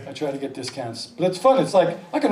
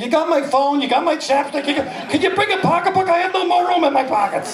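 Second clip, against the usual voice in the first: high, mounting exasperation; exasperated nagging; 8 semitones higher than usual. Acted. Couple bickering over full pockets, exasperated. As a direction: build from pestering questions to a fed-up complaint.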